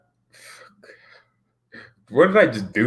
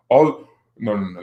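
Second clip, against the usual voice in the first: ordinary talk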